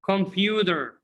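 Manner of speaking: computer said compuder; American flap T. Shown as a demonstration. Teacher shows class computer as compuder. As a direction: soften the T in computer to a D. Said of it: "Repeat after me".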